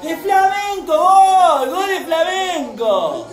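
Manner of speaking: high, loud ecstatic goal shouts; goal celebration yell. Real, not acted. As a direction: yell like the team just scored.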